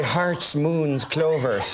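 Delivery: cartoon Irish accent, cereal-mascot style; hammy stage-Irish lilt; Lucky Charms Irish. Shown as a demonstration. Actor mocking his own bad accent, Lucky Charms Irish. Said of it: "evidently my accent's very Lucky Charms".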